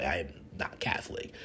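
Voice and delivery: a soft, unvoiced admission; whispered confession. Real, not acted. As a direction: confide it in a whisper.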